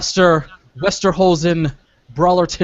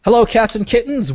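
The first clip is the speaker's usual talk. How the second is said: announcer voice; 6 semitones higher, 4 dB louder than usual. Acted. Podcast intro host voice.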